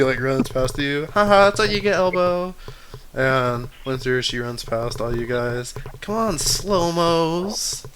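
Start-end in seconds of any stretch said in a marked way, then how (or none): 1.1-2.5 teasing taunt. Acted. Character taunting Elbow. 6.0-7.9 slow taunt. Acted. Winter taunts runners.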